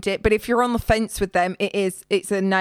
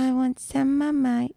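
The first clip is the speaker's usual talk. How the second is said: put-on higher, slow whiny baby voice; mocking baby talk; 4 semitones higher, slower than usual. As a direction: whine it like a toddler wanting a snack. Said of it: "he started talking in a baby voice".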